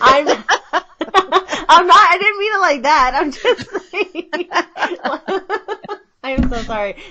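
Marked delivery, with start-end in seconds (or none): 0.0-6.0 higher, laughing through the apology; giggling while backpedalling, caught out; embarrassed laughing. Real, not acted. Host laughing at her own slip about getting older, embarrassed giggle.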